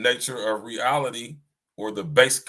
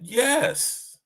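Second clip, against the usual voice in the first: much higher, mock-astonished confirmation; surprised yes; 12 semitones higher than usual. Most High confirming the guess, surprised. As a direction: imitate a surprised, high-pitched agreement. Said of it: "As if the Most High was surprised".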